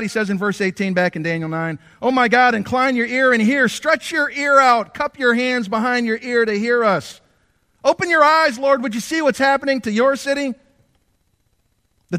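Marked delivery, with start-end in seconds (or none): 2.0-10.5 high, loud pleading prayer at full force; fervent preaching. Acted. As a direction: cry out to God for the city. Preacher voicing Daniel's prayer.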